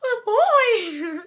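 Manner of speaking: a lightly voiced mom losing it over a boy; stretched-out mock gush from mom, laughing; mom's excited gasp. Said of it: "my mom was just like".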